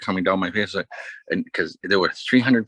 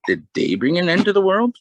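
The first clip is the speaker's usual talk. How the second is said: mock incredulous question; 12 semitones higher, 5 dB louder than usual. Comedian questioning the double standard for women, mock incredulous. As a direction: pitch climbing high and louder to the end.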